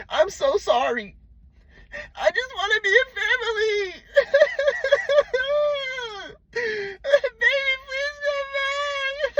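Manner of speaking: very high, wailing fake sob; mock crybaby plea. Mocking a jealous man begging his ex, high and sobbing. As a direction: ham up a whiny begging cry. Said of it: "this is how you sound like to her".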